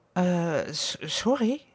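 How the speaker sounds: hesitant apology, catching on the first sound; stammering. A narrator's reading. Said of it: "stamelt hij". (stamelend, onzeker)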